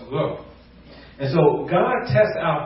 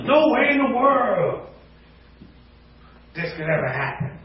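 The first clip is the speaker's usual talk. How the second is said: higher, milder repeat of the overdone protest; quicker, lighter take of the excuse; exaggerated refusal; 7 semitones higher than usual. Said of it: "we over-exaggerate".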